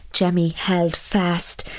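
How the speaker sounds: ordinary delivery, nothing marked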